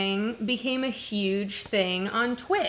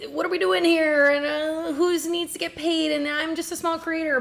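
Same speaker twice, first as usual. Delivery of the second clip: lightly voiced worried creator, higher pitched; confused small creator; 7 semitones higher than usual. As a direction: fret as a little creator, pitched up.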